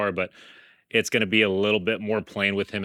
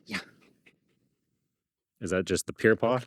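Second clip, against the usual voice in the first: ordinary delivery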